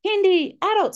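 put-on very high, loud child shouts; imitated kids calling. Kids behind mum casting spells, high imitated shouts. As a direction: fire off spells as little kids. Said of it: "three or four kids behind me".

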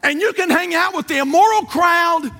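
high, driving revival sermon; full-force preaching. Real, not acted. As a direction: preach it hard, building to a final shout.

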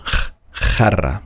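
isolated ch-, a pause, then jarra with that sound; back CH as in auch. Shown as a demonstration. Teacher warning against the ich sound, ch- and jarra from the back. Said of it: "wie das C H vom auch".

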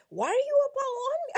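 high, loud and fast mock outburst; retold loud confrontation. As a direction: fire it back at him, high and quick. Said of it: "I said".

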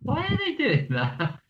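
put-on high, slow curious kid; child voice. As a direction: ask it like a puzzled child. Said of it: "went up to his dad and was like".

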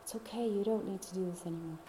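soft, gentle soothing; gentle reassurance. Retold or imitated. Speaking softly to the parts of herself, gently. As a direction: comfort a scared part of yourself quietly. Comforting her own parts.

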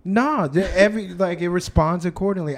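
ordinary talk, nothing marked

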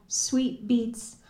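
usual speaking voice

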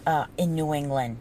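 ordinary talk

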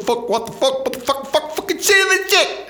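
high, hammed-up sweary outburst; mock cursing meltdown. Host fed up with endless lockdown, comic outburst. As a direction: climb into a squealed rant of curses.

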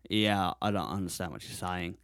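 put-on reply in a casual Australian accent; Australian local. Australian man baffled by a visitor's question, lightly put-on Australian accent. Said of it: "a guy in the lobby in Australia".

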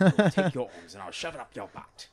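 put-on New Zealander threat; Korg. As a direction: play the big rock dude with a Kiwi accent. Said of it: "Korg from Thor, the big rock dude".

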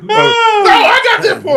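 high and breaking up with laughter; laughing hard. Real, not acted. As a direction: squeeze it out through laughter.

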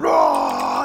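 put-on low roaring baby monster. The undead baby jumps out at the girl with a low roar. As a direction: roar it out like a jump scare. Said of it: "undead baby thing going".

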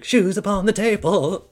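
very high, loud theatrical intoning; melodramatic narrator. Acted. Narrator echoing the mother's superstition, hammed-up and high. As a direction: chime in like an over-the-top musical narrator. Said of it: "the narrator is like".

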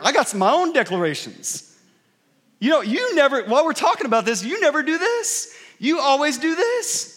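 high, loud mock-indignant retort; accusatory outburst. Spouse hits back with their own declarations, loudly accusing. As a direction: fire back blanket accusations, pitch shooting up. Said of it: "those blanket statements, those declarations that they speak over you".

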